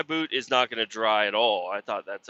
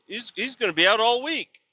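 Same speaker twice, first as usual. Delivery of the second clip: lightly voiced boss; 10 semitones higher than usual. Boss quoted in higher voice.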